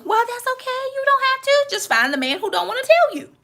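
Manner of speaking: high, light teasing comeback; playful high retort. Real, not acted. Preacher teasing an independent woman, high and playful. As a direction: toss it back with a sly grin.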